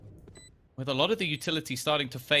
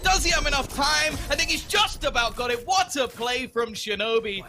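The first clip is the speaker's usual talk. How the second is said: excited play-by-play, rising pitch; esports caster; 10 semitones higher than usual. Real, not acted. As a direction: build hype as the clutch unfolds. Caster hypes a clutch.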